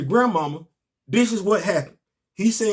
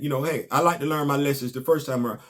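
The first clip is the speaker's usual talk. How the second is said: ordinary talk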